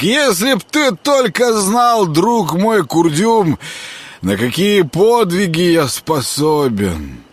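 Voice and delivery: put-on slow, high drunken bragging; drunk slurring. A narrator's reading. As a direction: brag slowly like a drunk. Drunk Grekhvost boasting.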